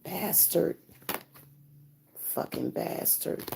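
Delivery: whispered bitter curse; muttered curse. Real, not acted. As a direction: hiss it under your breath. Muttered curse after silence.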